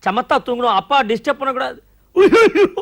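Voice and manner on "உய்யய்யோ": high, loud shocked exclamation; startled cry. Acted. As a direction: yelp in sudden alarm. Startled by the baby's stubble.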